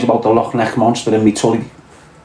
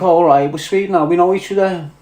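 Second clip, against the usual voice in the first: pitched up, hopeful inner voice; lightly raised self-quote; inner thought voiced high; 6 semitones higher than usual. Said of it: "and I think".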